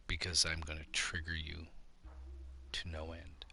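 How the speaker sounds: breathy whispered threat, almost silent; mock menacing whisper. Real, not acted. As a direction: drop to a teasing whisper. Threatening aside to offended viewers.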